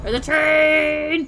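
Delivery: high, slow, drawn-out cry of "There's a train!"; high, slow mock-alarmed call; excited alarm. Real, not acted. Sees a train while driving.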